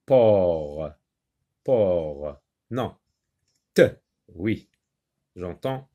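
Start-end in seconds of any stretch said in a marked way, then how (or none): 0.0-2.4 "port" low and drawn out, t left silent; teacher sounding out just "por" from porte; isolated syllable. Shown as a demonstration. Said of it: "Dans la syllabe port".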